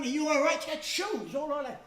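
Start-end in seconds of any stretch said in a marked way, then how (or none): 0.0-0.6 higher, louder mock-concerned call; calling out to wife. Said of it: "I'm going".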